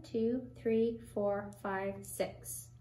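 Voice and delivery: normal talking voice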